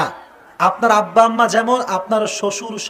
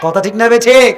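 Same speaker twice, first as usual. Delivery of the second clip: shouting to the crowd; 7 dB louder than usual. Real, not acted. Preacher calls out to crowd.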